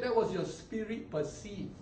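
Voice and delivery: normal talking voice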